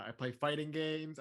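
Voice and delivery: ordinary delivery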